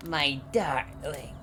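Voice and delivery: slow, tender motherly voice; a soft, slow old mother's greeting; old Russian mother. Acted. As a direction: greet her daughter lovingly, very slowly.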